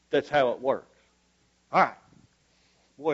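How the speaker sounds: usual speaking voice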